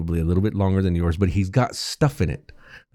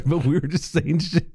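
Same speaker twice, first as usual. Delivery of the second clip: ordinary talk, nothing marked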